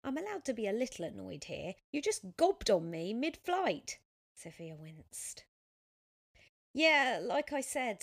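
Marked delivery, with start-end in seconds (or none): 0.0-4.0 mildly indignant, raised pitch and volume; annoyed. A narrator's reading. Cyclist to the woman who spat on him, annoyed. 6.7-8.0 loud, drawn-out, higher opening; exasperated apology. A narrator's reading. Sophia apologising to the annoyed cyclist, exasperated.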